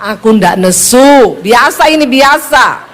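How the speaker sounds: rising, emphatic insistence; imitated Sulawesi wife's forceful denial. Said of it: "nadamu tuh tinggi".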